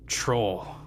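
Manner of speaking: low, quiet and unbothered; calm. Acted. Barkeep calmly naming the monster, quiet and low. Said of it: "looks at him calmly and just says".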